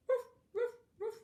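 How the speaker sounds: high, loud little-dog woofs; yappy dog. Acted. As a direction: yap like a tiny dog. Barking like little dogs.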